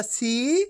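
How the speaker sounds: सी stretched long, rising in pitch. Shown as a demonstration. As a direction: draw out सी on an upward glide. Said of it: "खींच खींच के पढ़ना है".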